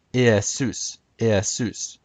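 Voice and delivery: IESUS as ee-eh-SOOS, stress last; classical Greek pronunciation. Shown as a demonstration. Presenter teaching Homer's-time Greek, classical Greek pronunciation. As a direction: say Iesus with short eh in the middle. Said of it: "In classical Greek".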